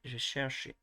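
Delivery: "je cherche" in an English accent with an English R. Shown as a demonstration. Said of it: "an American accent or an English accent".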